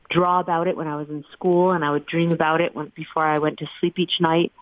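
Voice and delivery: ordinary delivery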